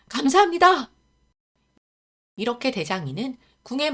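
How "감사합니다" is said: higher still, loud thanks; boy overflowing with gratitude; even more grateful joy. Acted. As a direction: thank him even harder.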